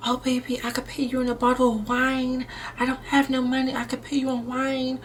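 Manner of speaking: high, soft imitation of his excuse; mock pleading man. Imitates man offering wine.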